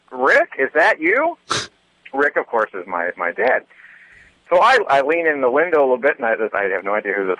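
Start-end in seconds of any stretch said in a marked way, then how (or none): 0.1-1.4 mild mock yell, higher and slow; drawn-out, high call, not at full volume; retold yell. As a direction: imitate someone calling out from a car, pitched up. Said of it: "the voice yells out".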